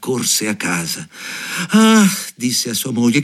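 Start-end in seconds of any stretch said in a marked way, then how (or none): 1.7-2.1 high, loud cry of woe; lament. A narrator's reading. Carter coming home to his wife, loud lament. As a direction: cry out in dismay.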